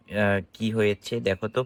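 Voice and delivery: ordinary delivery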